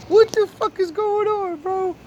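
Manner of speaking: high-pitched disbelief; incredulous. Real, not acted. Reacting to the absurd news, incredulous. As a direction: ask it high, baffled by the absurdity.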